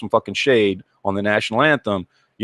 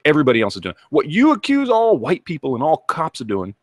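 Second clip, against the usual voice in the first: normal talking voice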